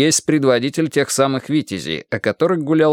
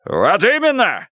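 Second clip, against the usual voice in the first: angry shout; 9 semitones higher, slower than usual. Acted. Karpov shouts.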